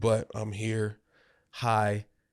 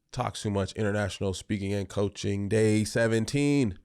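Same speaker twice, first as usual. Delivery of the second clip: ordinary delivery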